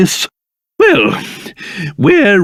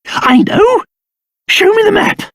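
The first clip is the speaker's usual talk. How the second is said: high, eager exclamation; sudden inspiration; 12 semitones higher than usual. Acted. Traveller struck by an idea about the map, excited. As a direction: seize on the idea and demand action.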